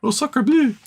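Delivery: high, hammed-up French "Oh, sacre bleu"; imitating the French Acadians being kicked out, in a silly French accent; mock French. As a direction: squeal it like a cartoon Frenchman. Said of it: "And they're like".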